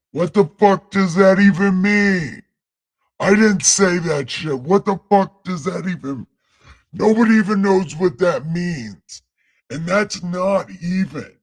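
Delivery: angry shout. Real, not acted. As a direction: rant at the nonsense. Outraged at the verse.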